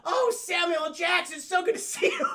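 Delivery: high, loud overjoyed greeting; hammed-up relief. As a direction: gush at the rescuer. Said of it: "She goes".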